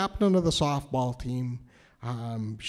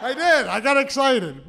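excited; 8 semitones higher, 5 dB louder than usual. Real, not acted. Excited confession, laughing.

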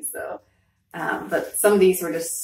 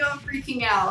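freaking out; 6 semitones higher, 3 dB quieter than usual. Retold or imitated. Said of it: "I was like".

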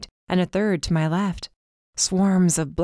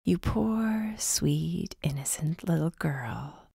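eerie whisper; 7 dB quieter, much slower than usual. A narrator's reading. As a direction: whisper it like a hooded threat. Hooded figure taunts captive girl.

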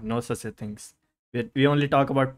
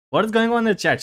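high, louder exasperated question; pitched-up, raised-voice telling-off; scolding the chat; 8 semitones higher, 5 dB louder, faster than usual. Real, not acted. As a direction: demand an answer from rowdy viewers.

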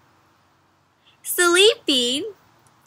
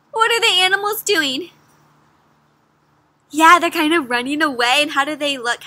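ordinary delivery, nothing marked

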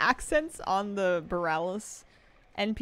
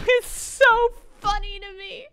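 laughing; 13 semitones higher, 10 dB louder, slower than usual. Real, not acted. Laughing at the voice line.

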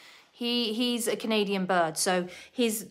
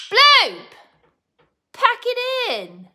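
very high, loud telling-off; scolding shout; 14 semitones higher, 8 dB louder, slower than usual. Real, not acted. Owner scolds screeching bird, high-pitched shout. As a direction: shriek at the pet to stop.

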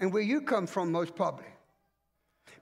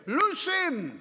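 Jesus commanding; 7 semitones higher than usual. Retold or imitated. Jesus orders Lazarus unbound, ringing command. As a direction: order them to unbind him. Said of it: "Jesus said".

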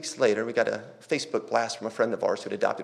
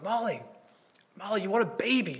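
higher, eager call to his wife; 9 semitones higher than usual. Retold or imitated. Husband calls wife Molly about a foster baby, eager raised call. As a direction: call her over, bursting with the news.

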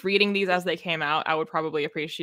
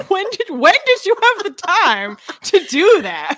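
high, loud and slow disbelief; who had time for that?; incredulous; 12 semitones higher, 9 dB louder, slower than usual. Retold or imitated. Co-host mocking the plot, incredulous high-pitched disbelief. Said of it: "I'm like".

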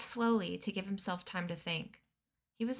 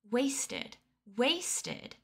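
higher-pitched indignant outburst, only mildly shouted; outraged team leader, voice pitched up; angry shouting; 5 semitones higher than usual. A narrator's reading. Team leader raging at workers over wasted food, acted shout. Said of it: "Chugger was shouting".

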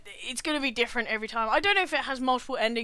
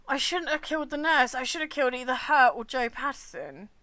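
ordinary delivery

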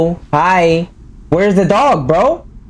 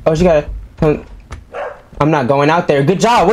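ordinary delivery

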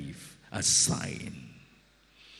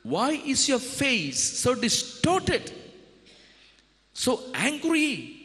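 much higher, slow concerned question; God questions an angry Cain; God asking; 13 semitones higher, slower than usual. Acted. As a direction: ask with concern. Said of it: "God asked him".

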